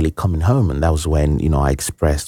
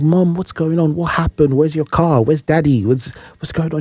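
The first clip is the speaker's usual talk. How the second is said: high, frightened questions tumbling out; a scared boy begging his mum for answers; panicked questions; 9 semitones higher than usual. Retold or imitated. Boy rushing to wailing mother.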